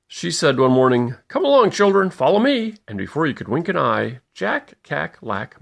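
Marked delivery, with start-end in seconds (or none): 1.3-2.8 higher and louder, calling the ducklings to follow; a mother duck rounding up her brood. Acted. Mrs Mallard calls ducklings.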